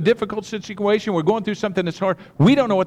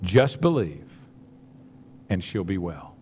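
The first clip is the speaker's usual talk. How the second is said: soft, low, slow comfort; tender reassurance; 12 semitones lower, 4 dB quieter than usual. Retold or imitated. Jesus gently reassuring Jairus, soft and low. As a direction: gently reassure a frightened father. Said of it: "he looks at him and he says".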